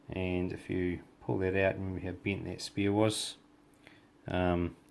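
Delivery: ordinary talk, nothing marked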